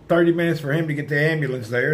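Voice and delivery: normal talking voice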